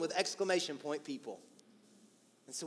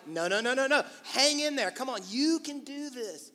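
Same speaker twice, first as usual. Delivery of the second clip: quick protest, then raised, insistent pep talk; acted cheerleader friend, drawing out the last words; urgent encouragement; 6 semitones higher than usual. Acting out friends refusing quitting.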